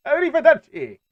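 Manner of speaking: high, loud mock-Italian cry; over-the-top comic send-off; hammed-up foreign farewell. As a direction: sing out a cartoonish goodbye. Mock foreign police sign-off, wrong country.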